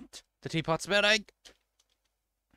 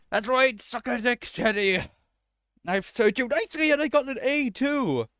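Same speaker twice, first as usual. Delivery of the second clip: high, excited crowing; high-pitched victory taunt; triumphant gloating; 8 semitones higher, 4 dB louder than usual. Real, not acted. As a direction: crow over your win at the loser. Gloats at Teddy over winning.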